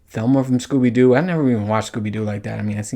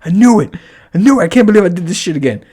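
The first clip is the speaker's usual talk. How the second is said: loud, fast frustrated outburst; angry rant; 6 semitones higher, 5 dB louder, faster than usual. Retold or imitated. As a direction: vent at yourself, fed up. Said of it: "I was so mad".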